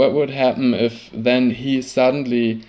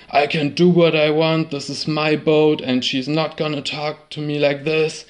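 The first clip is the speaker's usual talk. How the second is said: a retold rage at a higher pitch; retold screaming; 4 semitones higher than usual. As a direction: shout it back the way the angry captain did. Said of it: "Rockne just kept on screaming".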